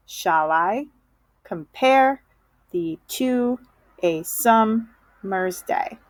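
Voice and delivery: slow iambic beat, shall I, com-PARE, thee TO, a SUM-mer's DAY; exaggerated da-DUM metre on "Shall I compare thee to a summer's day"; every other syllable stressed. Shown as a demonstration. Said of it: "If I were to mark up this first line".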